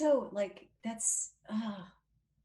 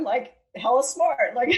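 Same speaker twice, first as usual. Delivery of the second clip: laughing loudly through the words; 4 semitones higher, 12 dB louder than usual. Real, not acted. Guest laughing about being called a dumb blonde. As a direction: laugh as you insist you're smart.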